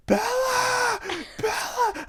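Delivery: piercing high scream of her name. Retold or imitated. Friend imagining calling Bella in the woods, screaming. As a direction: shriek her name in terror. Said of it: "I'd just be screaming".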